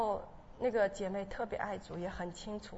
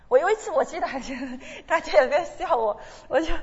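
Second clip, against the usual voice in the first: loud, laughing as she tells it; 6 semitones higher, 11 dB louder than usual. Real, not acted. Telling the church how everyone laughed, laughing loudly. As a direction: laugh through the memory.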